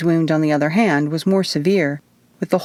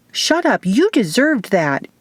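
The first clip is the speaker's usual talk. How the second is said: sharp, higher scolding; 6 semitones higher than usual. A narrator's reading. Izzy scolding the wounded man, sharply. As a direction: scold him for what he deserves. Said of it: "Izzy scolded".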